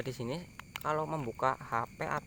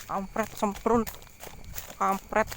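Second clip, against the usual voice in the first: panicked cursing; 6 semitones higher, much slower than usual. Real, not acted. Swearing while fleeing in panic.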